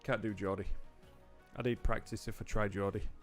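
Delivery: ordinary talk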